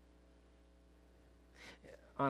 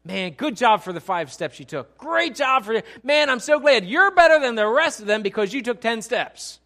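much louder, higher hammed-up cheering; mock praise; 9 semitones higher, 13 dB louder than usual. What Jesus does not say to Peter, hammed-up mock praise. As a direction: overdo the flattery as a joke. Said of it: "he doesn't say to him".

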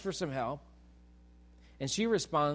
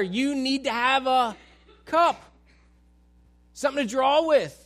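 louder and higher, scornful and laughing; mocking sarcasm; 6 semitones higher, 7 dB louder than usual. Samaritan woman mocking Jesus about the water, loud and scornful. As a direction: talk down to him like he's an idiot. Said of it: "kinda throwing back sarcasm".

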